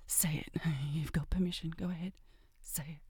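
low, intimate breathy whisper; seductive whisper. Acted. She whispers demands to her lover. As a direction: whisper it close to the ear.

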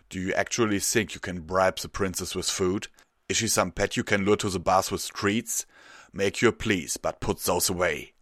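loud scolding in steady pitch; reproachful. A narrator's reading. Guard captain scolding petitioners who bring pastries, loud reproach. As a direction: tell them off sternly and loudly. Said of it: "his reproachful words".

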